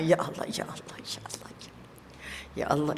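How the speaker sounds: soft, low ya Allah on each breath; zikir with the breath. Shown as a demonstration. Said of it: "ikut nafas kita".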